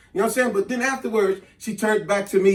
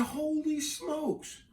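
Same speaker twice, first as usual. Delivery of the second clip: slow, hushed, higher-pitched amazement; stunned; 7 semitones higher, 11 dB quieter, much slower than usual. Retold or imitated. Man spotting her twin sister, hushed slow astonishment. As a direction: draw it out softly, awestruck.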